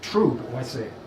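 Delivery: ordinary talk, nothing marked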